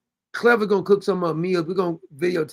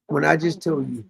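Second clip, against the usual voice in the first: ordinary talk, nothing marked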